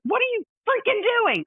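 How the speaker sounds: high shouted "What are you freaking doing?"; angry shout at a reckless driver; yelling at driver. Retold or imitated. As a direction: yell at the speeding kid, pitch way up. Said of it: "I yell at the guy".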